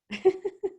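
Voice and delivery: short chuckle to oneself; brief amused chuckle; amused laugh. Real, not acted. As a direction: laugh briefly at something funny. Amused by a comment.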